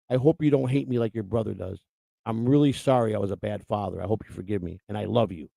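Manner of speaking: low, subdued retelling of a father's apology; tearful apology, retold. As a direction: quietly voice a remorseful father, understated. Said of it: "tears came out of his eyes".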